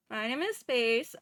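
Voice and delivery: normal talking voice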